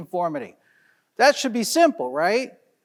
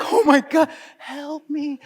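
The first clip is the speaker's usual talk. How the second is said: hammed-up helpless panic, dropping to a small voice; mock freaking out; 3 semitones higher, slower than usual. Voicing the kid who is holding it together, mock freaking out. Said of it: "He's freaking out".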